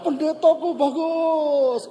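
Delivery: high, loud, slow echo; imitated ringing answer, high and drawn out; echo answering back. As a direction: imitate a ravine returning the call, stretched out. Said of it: "Jawab salah".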